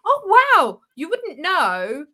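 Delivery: high, slow gushing voice; gushing surprised stranger. Retold or imitated. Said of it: "people go".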